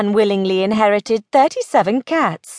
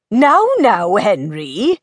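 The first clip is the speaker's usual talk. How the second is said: raised, then dropping, mild scolding; clucking reproof; 3 dB louder, slower than usual. Acted. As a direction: cluck at the listener in gentle reproof. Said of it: "said with a cluck".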